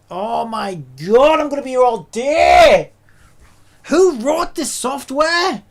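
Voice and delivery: very loud, high, exasperated yelling; furious shout. Real, not acted. User yells about losing progress, real furious shout. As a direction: explode at the software at full volume. Rages at the software.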